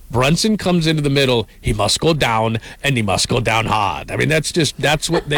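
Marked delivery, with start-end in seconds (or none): 0.1-4.1 Drago impression. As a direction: do a hammy Russian heavy giving orders. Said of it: "that was a terrible impression".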